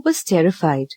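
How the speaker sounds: ordinary delivery, nothing marked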